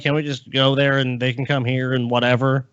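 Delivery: ordinary talk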